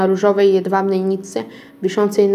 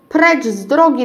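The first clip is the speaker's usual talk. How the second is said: higher, loud angry bellow; furious roar; 5 semitones higher, 3 dB louder than usual. A narrator's reading. Witch roaring at the crowd, loud and higher. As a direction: bark them out of her way. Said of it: "ryczała rozzłoszczona Filomena".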